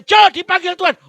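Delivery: loud, high call of the master. Acted. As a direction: call Jo sharply from across the house. Master calling the slave. (panggilan keras sang majikan)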